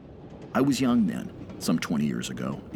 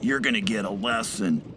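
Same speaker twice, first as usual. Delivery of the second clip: put-on higher, menacing drunk's voice; hollered threat; 5 semitones higher than usual. A narrator's reading. Drunk laborer threatening the young foreigner. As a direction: threaten him as if ready to charge. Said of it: "he hollered".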